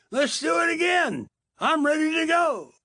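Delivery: high, excited whoop of a thrilled surfer; hollering with joy. Retold or imitated. Surfer popping out of the water after a wipeout, whooping with joy. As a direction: yell it out, thrilled to go again.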